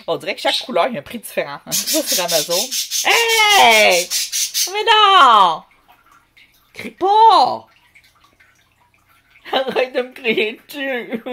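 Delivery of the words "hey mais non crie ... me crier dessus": loud, very high-pitched yelling; shouted scolding. Real, not acted. Vlogger telling a screamer to stop yelling at her, shouted scolding. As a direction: yell sharply to stop the screaming. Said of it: "c'est violent de crier".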